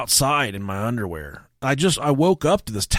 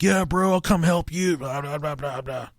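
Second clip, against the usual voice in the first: lightly faked phone chat; 4 semitones higher than usual. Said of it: "he was like".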